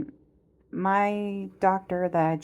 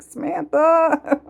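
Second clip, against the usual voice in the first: much higher, louder, slow and stretched; drawn-out alarmed call; 11 semitones higher, 9 dB louder, slower than usual. Retold or imitated. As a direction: wail her name while gripping the seat.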